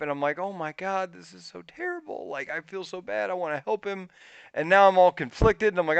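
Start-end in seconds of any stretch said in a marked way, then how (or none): 0.4-4.1 guilt-stricken. Retold or imitated. Acted guilt over the squirrel.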